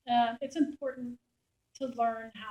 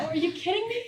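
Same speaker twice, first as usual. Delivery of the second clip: laughing, high and loud; laughing disbelief; 8 semitones higher, 9 dB louder than usual. Real, not acted. Reporter laughing at the soldiers' code word, loud. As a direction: laugh out the question.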